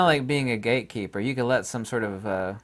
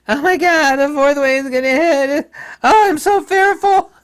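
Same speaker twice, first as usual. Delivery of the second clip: high, loud mock panic, much higher; mocking fearful woman; 14 semitones higher, 10 dB louder than usual. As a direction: ham up a frightened official crying doom. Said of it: "was fearmongering".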